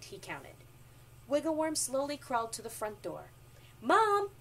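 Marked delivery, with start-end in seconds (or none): 3.8-4.3 high, loud call to a parent; child's yell for mom. A narrator's reading. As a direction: holler for someone in another room. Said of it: "he yelled".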